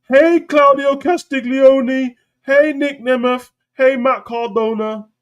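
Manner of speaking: high, loud, slow showboating callout; mocking Cena. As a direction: drawl the names like a hammed-up wrestler. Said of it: "Where's the camera?".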